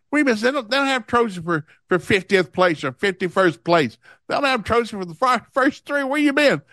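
high-pitched mock parental rant; exasperated scolding. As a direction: scold her in disbelief, pitched well up. Father scolds daughter over lost race.

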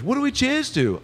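high-pitched, lilting star impression; high mimicked celebrity voice. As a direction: greet in a high put-on voice. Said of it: "Paul McCartney's here".

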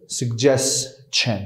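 suggestion stretched out slowly as 'sug-jes-chen'; very slow pronunciation. Shown as a demonstration. Teacher spelling out the ch sound very slowly. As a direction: draw out suggestion, syllable by syllable.

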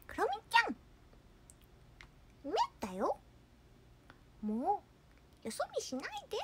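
very high, cute mascot voice; My Melody. Acted. Mascot gently scolding Kuromi for looking away, high and cutesy. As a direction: squeak it sweetly like a cartoon mascot. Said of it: "マイメロはだって".

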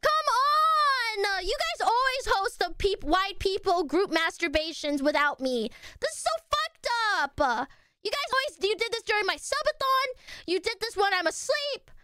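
high, aggrieved mock whine; hammed-up wail of unfairness; mock whining. Mock outrage at being excluded.

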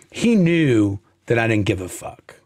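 usual speaking voice

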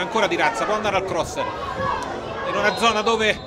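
rising excitement, shouts at the crossbar; excited play-by-play. Real, not acted. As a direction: call the near miss with a burst. Chance at the crossbar.

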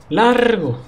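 high loud order to be gone; loud high banishing shout; shouting away. Acted. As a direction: yell it to drive off the storm.

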